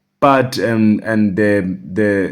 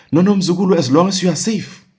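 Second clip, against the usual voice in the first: higher, eager reassuring no no; relieved reassurance; 9 semitones higher, faster than usual. Retold or imitated. Uncle tells his nephew he only cares he's safe, reassuring. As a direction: brush the worry aside kindly.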